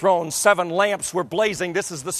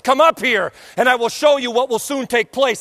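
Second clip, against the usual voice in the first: loud calling voice; 7 semitones higher, 5 dB louder than usual. Acted. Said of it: "spoken to me like a shofar said".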